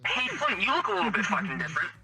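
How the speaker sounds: normal talking voice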